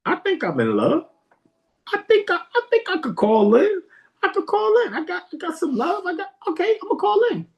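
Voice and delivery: high, giddy, eager caller; excited caller. Retold or imitated. Young man hyping himself up to call the radio. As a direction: gush like a lovestruck fan about to call. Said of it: "And I was like".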